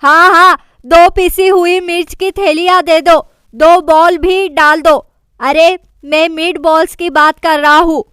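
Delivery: shouting out in his sleep; loud sleep-talking shout. A narrator's reading. Sleepwalking JJ shouts at Mujo beside the bed, loud yell. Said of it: "जोर से चिल्लाकर कहता है".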